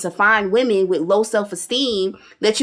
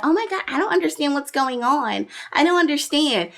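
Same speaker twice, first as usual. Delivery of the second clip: mocking feigned confusion; 6 semitones higher than usual. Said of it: "then she's like".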